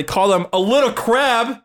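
lifted, slowed sing-song; playful high call. Real, not acted. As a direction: stretch it out like a tease. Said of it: "next time you wanna insult somebody".

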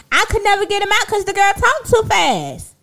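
high, sustained wail of frustration; exasperated complaint. Real, not acted. Venting about never getting a word in with her sister, exasperated. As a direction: whine it out high, falling at the very end.

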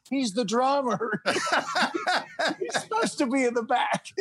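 amused high laughing reply; laughing uncle. Retold or imitated. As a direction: quote the uncle while cracking up. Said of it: "my uncle saying".

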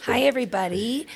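plain reading voice

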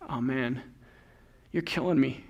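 low, hushed complaint; mock-defeated, under the voice; weary inner groan. Parent in the congregation reacting to the list, quietly overwhelmed. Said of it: "you might start thinking".

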